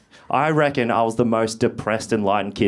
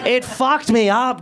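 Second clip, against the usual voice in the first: usual speaking voice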